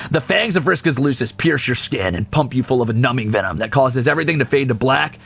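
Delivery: ordinary talk, nothing marked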